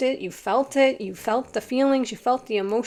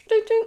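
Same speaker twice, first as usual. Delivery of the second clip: mock bell ding; 14 semitones higher, 6 dB louder than usual. Mimics a bell going off.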